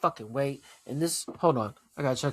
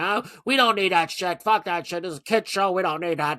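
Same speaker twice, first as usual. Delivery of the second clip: a hammed-up whiny complainer, a bit higher and louder; mock outraged troll voice; 4 semitones higher, 5 dB louder than usual. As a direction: parody the dumb haters mid-rant. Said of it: "That's how you sound".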